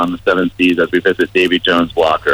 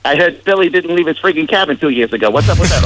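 mock gossip; 9 semitones higher than usual. Mock gossip about Billy.

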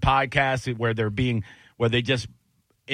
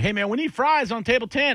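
kitchen manager calling out; 12 semitones higher than usual. Retold or imitated. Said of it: "kitchen manager in the kitchen".

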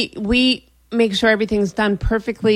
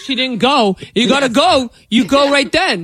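higher, mocking guy voice; dumb-guy impression; 3 semitones higher than usual. Woman imitating guys scolding the dog, mockingly dopey. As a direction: imitate a dense boyfriend. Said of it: "Guys are like".

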